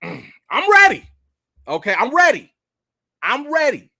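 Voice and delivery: loud, high, excited sales pitch to Marvel; hyped self-pitch. Pretend. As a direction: pump it up like you're auditioning on the spot.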